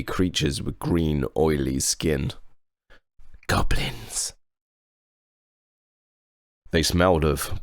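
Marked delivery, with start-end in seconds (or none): 3.5-4.3 hushed spooky whisper; whispered reveal. A narrator's reading. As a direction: whisper the word like a warning. Ominous reveal of goblins.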